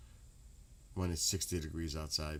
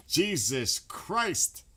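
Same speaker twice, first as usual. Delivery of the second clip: slow, drawn-out laugh, high and loud; laughing exasperation; 10 semitones higher, 9 dB louder, much slower than usual. Real, not acted. Streamer laughs at a viewer's joke, drawn out. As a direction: laugh it out in disbelief.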